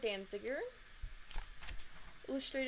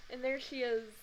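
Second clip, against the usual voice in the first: usual speaking voice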